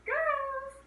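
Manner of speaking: high call across the house; father coming home and calling his daughters. Retold or imitated. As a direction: call out to the family.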